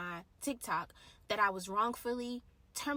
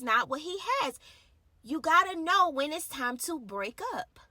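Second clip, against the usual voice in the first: preaching; 4 semitones higher, 8 dB louder than usual. Real, not acted. Preaching at full force.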